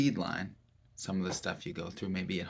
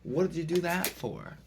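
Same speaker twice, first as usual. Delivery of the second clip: accusing; 7 semitones higher than usual. Shown as a demonstration. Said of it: "it could be embarrassing".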